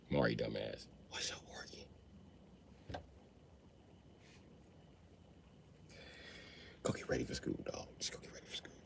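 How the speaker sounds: secretive whisper. Retold or imitated. Dad whispering to Mari about the son, hushed. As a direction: whisper it so the son can't hear.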